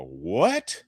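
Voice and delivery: high, loud shocked double take; gym-goer stunned by the before picture; shocked disbelief. Retold or imitated. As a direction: react like you can't believe the photo. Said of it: "And they go".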